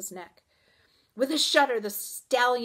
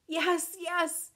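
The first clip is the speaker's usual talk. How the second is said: high, urgent assent; frantic agreement; desperate pleading; 9 semitones higher than usual. A narrator's reading. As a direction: insist in fear. Desperate girl begs.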